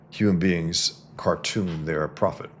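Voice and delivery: usual speaking voice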